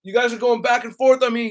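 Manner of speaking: normal talking voice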